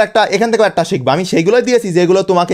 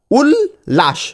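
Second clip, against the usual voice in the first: উল্লাস split "ul... lash", higher; piece by piece; 7 semitones higher, much slower than usual. Shown as a demonstration. Teacher presents the next word, উল্লাস in two high separate pieces. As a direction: break উল্লাস in two after "ul". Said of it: "টুকরো টুকরো করে উচ্চারণ".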